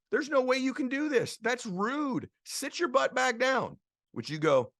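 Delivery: high, loud motivational preaching; preaching at full force. Acted. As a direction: rouse the crowd, high and driving.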